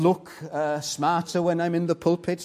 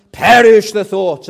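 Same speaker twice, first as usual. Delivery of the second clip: louder, dismissive outburst; loud rejection; 6 semitones higher, 16 dB louder than usual. Real, not acted. Preacher to a congregation tempted by good works, loud and dismissive. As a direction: throw the idea out forcefully.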